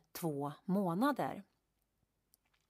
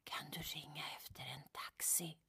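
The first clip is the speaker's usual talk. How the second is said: voiceless, drained whisper; faint whisper; 3 semitones lower, 13 dB quieter than usual. Retold or imitated. Burnt-out woman whispering to producer for a taxi. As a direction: whisper it, no strength left. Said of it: "jag kan viska till honom".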